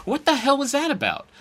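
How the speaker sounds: high-pitched, incredulous retort; indignant bafflement, voice shooting up; baffled outburst. Retold or imitated. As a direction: retell a disbelieving what-was-that. Retelling baffled reaction to incident.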